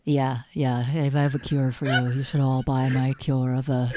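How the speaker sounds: a hint of a deep founder's pitch; Elizabeth Holmes voice. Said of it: "You can sound like Elizabeth Holmes".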